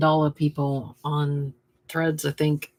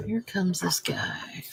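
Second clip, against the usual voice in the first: whisper; 7 dB quieter than usual. Real, not acted. Whispered while working.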